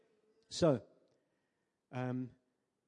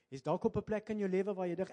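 ordinary talk, nothing marked

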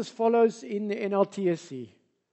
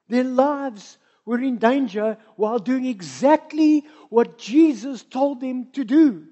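louder, driving sermon voice; full-force preaching; 2 semitones higher, 6 dB louder than usual. Acted. Pastor urging the congregation about storms, full-force preaching.

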